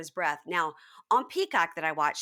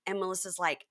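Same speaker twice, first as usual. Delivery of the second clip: ordinary delivery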